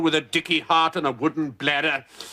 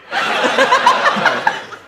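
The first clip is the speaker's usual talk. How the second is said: high wheezing laugh; 5 dB louder than usual. Acted. General's wheezing laugh after joke.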